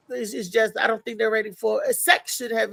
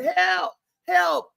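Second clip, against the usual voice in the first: retold cries for help; 7 semitones higher than usual. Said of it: "I jump up and start running".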